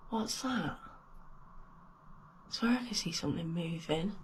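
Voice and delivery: very soft frightened voice; hushed and scared. Real, not acted. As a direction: hush it, spooked. Scared, quiet in haunted bathroom.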